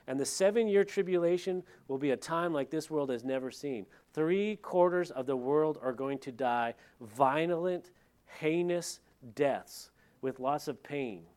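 ordinary talk, nothing marked

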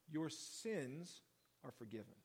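hushed solemn pronouncement; reverent hush. Retold or imitated. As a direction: let the words fall gently, like a blessing. Quoting Jesus's words.

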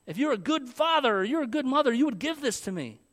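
put-on a touch of a child lecturing his parents; insistent child. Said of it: "he thinks".

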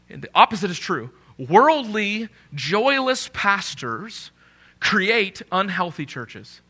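usual speaking voice

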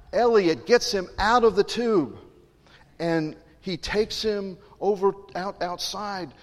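rising to the climax; excited. A narrator's reading.

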